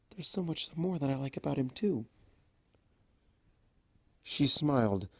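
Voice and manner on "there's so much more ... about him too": softer and faster, shy; hushed, hurried confession. A narrator's reading. Fluttershy admitting to herself she likes Discord, hushed and quick. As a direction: rush it out under your breath.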